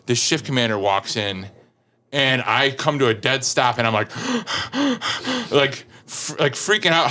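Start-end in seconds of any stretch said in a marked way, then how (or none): 4.1-5.5 acted out-of-breath panting; huffing and puffing. Storyteller shows himself stopped dead before the commander, panicked panting. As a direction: mimic frantic gasps for air. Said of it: "huffing and puffing".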